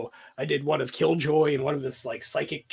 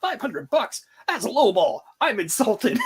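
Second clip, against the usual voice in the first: high, loud, laughing mock outrage; mock offended; 7 semitones higher, 6 dB louder than usual. Andrew offended by the cheap hit, loud laughing mock outrage. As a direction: act insulted at the cheap price, laughing. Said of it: "He's like".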